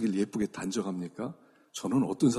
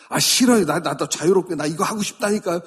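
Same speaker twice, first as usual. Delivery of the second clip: loud, high outburst of refusal; acted protest; 5 semitones higher, 9 dB louder than usual. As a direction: protest loudly like a balking child. Acted refusal of God's order.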